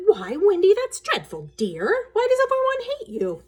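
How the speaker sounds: high, loud gasping mother voice; shocked mother. Acted. Mother reacts to daughter's hatred, high and shocked. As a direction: sound dismayed but gentle. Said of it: "She said".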